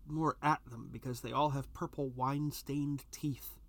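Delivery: usual speaking voice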